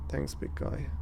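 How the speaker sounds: soft, whispered thanks; weak whisper. Acted. Wounded May thanking the big guy, weak whisper. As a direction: breathe it out, hurt.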